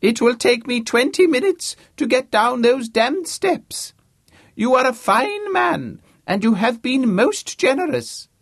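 put-on high, loud booming old academic; pompous old Vice-Chancellor. James impersonating a Vice-Chancellor to Harvey, booming. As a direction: play the grand deaf old don, full volume.

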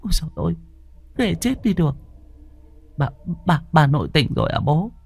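high, soft, stammering terror; stammering fright. Acted. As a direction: stutter it out, too scared to speak. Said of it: "lắp bắp". (lắp bắp sợ hãi, giọng cao)

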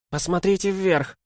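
high-pitched shout from above; calling out. A narrator's reading. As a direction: call down to them, high. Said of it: "крикнул им голос". (высокий окрик)

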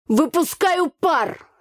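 high, slow, drawn-out call; businesslike shout. A narrator's reading. Brother answers from wrecked room, businesslike call. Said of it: "деловито отозвался Митька".

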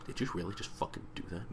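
very soft, quick muttered challenge; suspicious undertone. Retold or imitated. Voicing suspicious look.

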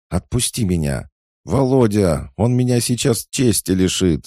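high, loud cry for help; panicked wailing. A narrator's reading. Pinned girl begs Volodya for rescue, wailing in panic. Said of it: "заголосила бедолага".

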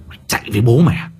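quick sneering threat; fast angry taunt. Acted. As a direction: bark it out fast. Repeated taunt, first take.

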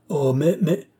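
exclamation un peu plus forte; s'écrie en comprenant enfin. A narrator's reading. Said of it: "s'écria le baron". (le baron s'écrie, soulagé)

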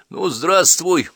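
usual speaking voice

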